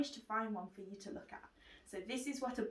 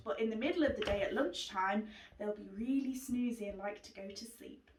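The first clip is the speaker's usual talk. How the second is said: ordinary delivery, nothing marked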